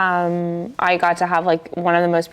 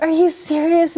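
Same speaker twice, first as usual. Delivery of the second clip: high, squealed disbelief; imitating daughter's surprise; 11 semitones higher than usual. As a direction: mimic her shocked delight, much higher. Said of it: "she's like".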